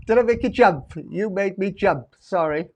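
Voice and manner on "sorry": ordinary delivery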